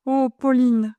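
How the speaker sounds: put-on young man crying out her name. A narrator's reading. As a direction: call her name out in a burst of feeling. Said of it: "m'écriai-je".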